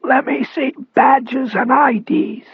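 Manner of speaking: slow, high strained rasp; gallant rasp. A narrator's reading. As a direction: stand up to them in a raspy voice. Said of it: "he said gallantly".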